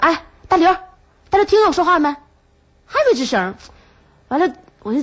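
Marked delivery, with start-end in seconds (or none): 0.0-2.1 higher re-enacted hail from a few metres; calling out. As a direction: imitate calling out to someone. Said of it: "我喊他，我说".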